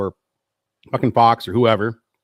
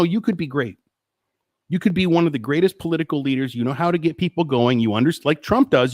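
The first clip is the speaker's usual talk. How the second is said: ordinary talk, nothing marked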